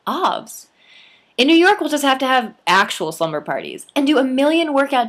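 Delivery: playful Libby. A narrator's reading. Libby playful excited reply.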